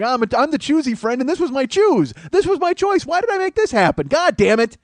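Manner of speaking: high whiny regretful wail, rising to a cry; mocking the choosy friend. Comic friend sulking at a show, high whiny wail. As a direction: whine like a friend regretting his choice. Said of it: "He's like".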